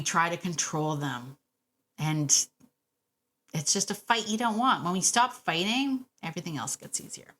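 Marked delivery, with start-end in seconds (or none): none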